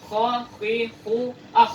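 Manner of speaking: throaty kh from the back of the throat; Arabic kha drill from the throat; Arabic letter kha drill. Shown as a demonstration. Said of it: "makrosnya itu dari dalam tenggorokan".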